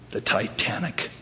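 soft, understated deadpan reply; deadpan answer. Acted. Man explains his revenge punch in a joke, deadpan. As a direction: drop the reason quietly, no fuss. Joke attacker gives curt reason.

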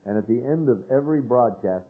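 normal talking voice

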